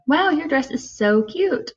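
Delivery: bright high gush on "Wow", sincere praise; mean every word of "Wow, your dress is so cute"; positive, gushing. Shown as a demonstration. Teacher demonstrating the friendly way, genuinely gushing. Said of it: "you can say in a positive way".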